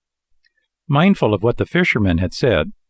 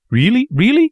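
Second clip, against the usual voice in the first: very high, louder, joyful disbelief; joyful cry; 4 dB louder than usual. A narrator's reading. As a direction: bounce up with delight. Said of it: "cried the marionette, jumping around with joy".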